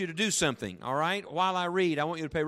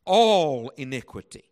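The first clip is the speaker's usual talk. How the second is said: loud, drawn-out "all"; pause, then punch "all" hard; "all" hit loudly and held; stress on ALL; 7 dB louder, slower than usual. Shown as a demonstration. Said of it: "I'll emphasize it as I read it".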